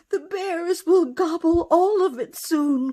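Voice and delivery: high, loud frantic cry; alarmed cry. Acted. Hares crying out fearfully about the bears, high and loud. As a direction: hares shrieking their fear. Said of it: "cried the hares".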